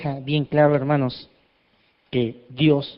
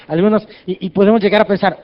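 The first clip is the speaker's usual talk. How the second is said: usual speaking voice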